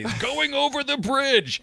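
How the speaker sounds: very high put-on local voice; mock it as an epic journey. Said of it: "As if that was, like, some sort of, like, massive trek".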